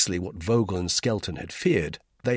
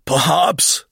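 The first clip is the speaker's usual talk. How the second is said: high, raised, nervous interjection; anxious; 9 semitones higher, 9 dB louder than usual. A narrator's reading. Vogel interrupts the quarrel, anxiously. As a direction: butt in anxiously, voice lifted. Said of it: "put in Vogel anxiously".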